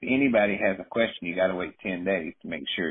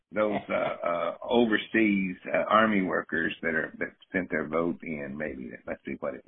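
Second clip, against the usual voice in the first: normal talking voice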